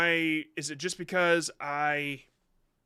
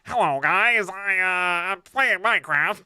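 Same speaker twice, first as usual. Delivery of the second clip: loud, higher put-on voice; hammed-up awful streamer intro, much louder; mock bad creator voice; 3 semitones higher, 9 dB louder, slower than usual. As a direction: blare it out as a joke caricature of a new YouTuber. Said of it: "how funny would it be if I played it and it was like".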